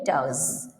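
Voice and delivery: "dogs" with the final S as a Z; Z ending. Shown as a demonstration. Teacher points out the pronunciation, Z ending on "dogs". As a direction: say "dogs" low, ending on a Z sound. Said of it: "S letter pronounce Z".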